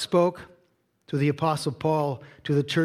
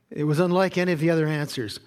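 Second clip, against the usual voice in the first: usual speaking voice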